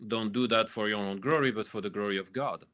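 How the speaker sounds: ordinary talk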